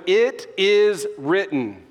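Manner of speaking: each word set apart, slow; emphatic declaration. Acted. Jesus answering the devil with scripture, slow and emphatic.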